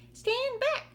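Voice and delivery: very high, loud shriek of alarm; shouting aunt. Acted. Aunt Sponge warning as the branch bends, high loud shout. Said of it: "Aunt Sponge shouted".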